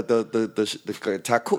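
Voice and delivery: ordinary talk, nothing marked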